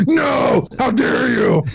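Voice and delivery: high, slow mock shout; high slow hammed-up indignation; mock outrage. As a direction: ham up the indignant yell. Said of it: "I just screamed at this lady".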